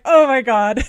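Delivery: loud, stunned exclamation; loud retold gasp of surprise; shocked oh my God. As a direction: blurt it out in shock at the tears. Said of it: "I was like".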